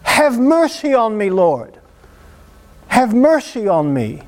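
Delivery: insistent mock cry for mercy. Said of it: "You gotta kick him in the shins".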